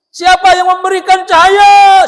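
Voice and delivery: high, loud challenging question to the crowd; trainer's loud question. Retold or imitated. Seminar trainer quizzing the audience, loud and high.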